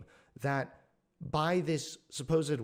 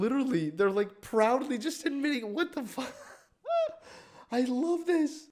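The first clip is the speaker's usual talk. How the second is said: high laughing disbelief; 9 semitones higher than usual. Real, not acted. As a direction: laugh out the outrage.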